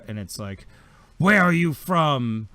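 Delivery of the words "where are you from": loud, drawn-out, high shouted demand; echoing interrogator. Acted. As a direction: bellow the question slowly, like an echo. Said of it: "the f-, voice echoes out".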